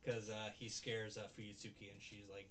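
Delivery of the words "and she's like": ordinary talk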